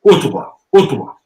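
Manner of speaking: "Ottawa." with a rounded oo start and a falling wah; old pronunciation. Shown as a demonstration. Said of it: "that's how you sound it in more of the old".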